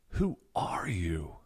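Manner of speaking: slow whispered Who are you, who stretched; whispered existential. Shown as a demonstration. Teacher contrasting the existential question, whispered. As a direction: whisper it slowly, overwhelmed by who they are. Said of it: "radically different question".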